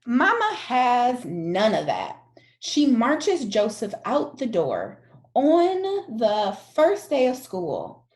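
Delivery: plain reading voice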